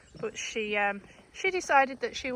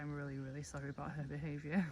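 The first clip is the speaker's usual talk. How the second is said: lower, softer apologetic voice; ashamed sheep voice; 8 semitones lower, 11 dB quieter, faster than usual. Retold or imitated. Farmer voicing Lila apologising for Minnie, low and soft. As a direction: voice the embarrassed mother ewe. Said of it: "She's like".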